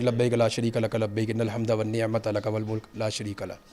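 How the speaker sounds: slow, low prayer recitation; reciting the talbiyah. Shown as a demonstration. Pilgrim reciting the talbiyah on the way to Hajj, slow and devout. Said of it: "تلبیہ، آ،".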